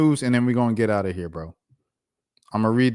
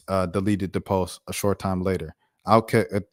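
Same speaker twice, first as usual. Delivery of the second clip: normal talking voice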